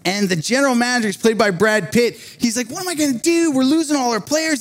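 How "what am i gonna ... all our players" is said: high-pitched exasperated retelling; frustrated. Retold or imitated. A preacher retells a movie manager losing all his players, frustrated. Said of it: "He's getting frustrated".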